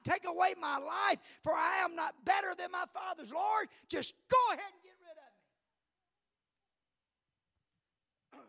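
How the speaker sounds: loud, pleading prayer of despair; desperate cry for death. Acted. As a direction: beg the Lord to end it. Elijah begging God to die.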